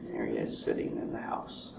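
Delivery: hushed storyteller aside; whispering. Acted. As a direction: whisper it like a secret.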